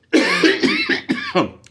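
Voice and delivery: loud, high, hacking fake cough; overdone comedic cough; exaggerated cough. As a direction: cough big like it's a joke.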